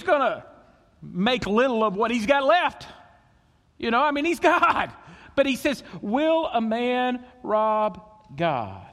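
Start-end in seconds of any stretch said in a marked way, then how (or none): 6.0-8.9 drawn-out, very slow rhetorical question; slow, deliberate divine challenge. Acted. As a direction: stretch each word of the question. God's question repeated, very slowly.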